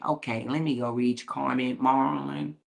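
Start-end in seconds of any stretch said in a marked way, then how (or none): none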